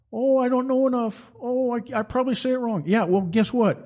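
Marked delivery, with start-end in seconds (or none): 0.1-2.8 higher, mock-meek whine; imitating a timid churchgoer's excuse. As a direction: play the nervous believer making excuses. Mocking timid believers' excuses.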